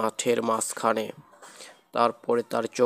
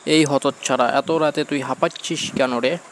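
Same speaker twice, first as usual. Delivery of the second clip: loud, raised-pitch telling-off; scolding grandmother; 3 semitones higher, 7 dB louder than usual. Acted. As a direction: play a grandmother loudly scolding a child. Grandmother scolds the panting boy. (ঠাকুমার চড়া গলায় বকুনি)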